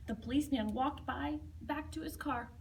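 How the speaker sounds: ordinary delivery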